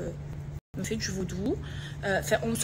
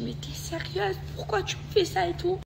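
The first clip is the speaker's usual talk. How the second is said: much higher, upset questioning; retold tearful reproach; 9 semitones higher than usual. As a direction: re-enact confronting a friend through tears. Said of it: "Et là, je suis en pleurs". (reproche éploré rejoué)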